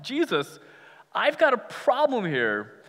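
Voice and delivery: pleading Mary. Retold or imitated. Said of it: "Mary is just asking for help".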